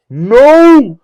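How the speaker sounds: long, high, very loud scream. Retold or imitated. Audience member screaming at the actor mid-play, loud outburst. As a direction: scream it across a whole theater. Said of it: "I just screamed out loud".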